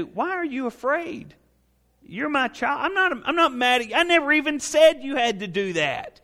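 put-on gently calm a terrified child; tender parent. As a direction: parent reassuring the child trembling in the corner.

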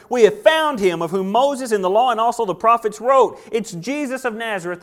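high and loud, thrilled; excited. Acted. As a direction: shout the news like you've searched forever. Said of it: "You can sense how excited he is".

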